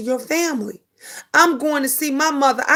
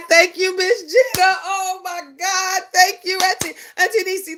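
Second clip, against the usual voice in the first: overjoyed; 6 semitones higher than usual. Real, not acted. Viewer's sister replied I love you.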